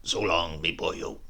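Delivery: put-on low, sly old man's goodbye; sly farewell. Wicked old man bidding narrator goodbye, sly. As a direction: let it slip out knowingly.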